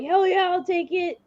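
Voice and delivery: high-pitched mock hoarse reply; put-on hoarse, excited acceptance; imitated hoarse voice. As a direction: imitate someone who has talked too much. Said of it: "in her hor-, hoarse voice".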